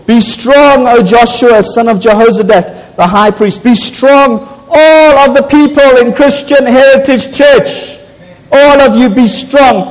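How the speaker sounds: rally the church like a trumpet call; preaching at full force. Acted. Preacher urging the church to stand firm, fervent exhortation.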